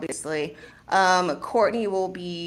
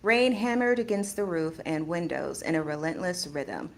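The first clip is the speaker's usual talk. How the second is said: measured, softer reading voice; story narration; 2 semitones lower, 6 dB quieter than usual. A narrator's reading. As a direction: narrate steadily and evenly. Starts reading the story aloud.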